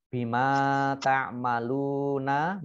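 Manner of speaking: slow "Bima ta'maluna", stretching "maa" and "luu"; "ta'maluna" with a long u; two-beat long vowels. Shown as a demonstration. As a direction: recite "Bima ta'maluna" in measured beats. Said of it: "masing-masing dibaca panjang dua harokat".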